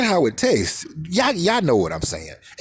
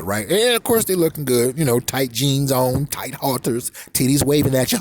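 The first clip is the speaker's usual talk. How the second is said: ordinary talk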